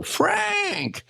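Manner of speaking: calling out. Retold or imitated. Calling back across lobby.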